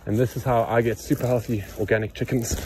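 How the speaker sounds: ordinary delivery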